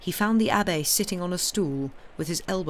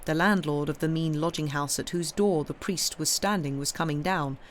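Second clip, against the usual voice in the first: ordinary delivery, nothing marked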